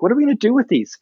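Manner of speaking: high-pitched imitation of a young girl; daughter's question. Parent voicing the daughter helping with cookies, high puzzled question. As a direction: lift your voice into a puzzled kid's.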